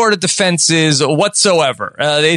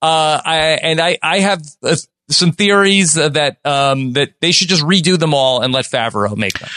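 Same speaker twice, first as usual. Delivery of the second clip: normal talking voice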